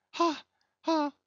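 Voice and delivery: sharp high crazed laughter; high mad laugh. Acted. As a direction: burst out in a mad cackle. Pip's sudden laugh.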